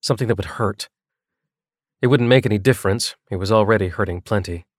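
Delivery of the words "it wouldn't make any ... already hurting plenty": ordinary delivery, nothing marked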